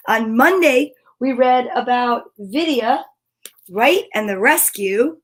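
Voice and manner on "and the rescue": ordinary delivery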